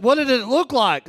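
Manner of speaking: high, loud eager question; excited tourist. Retold or imitated. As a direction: imitate a tourist bursting to know. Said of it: "he got so excited".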